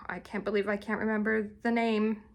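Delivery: ordinary talk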